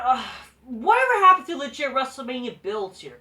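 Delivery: ordinary talk, nothing marked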